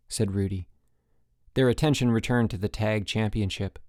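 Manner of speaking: ordinary delivery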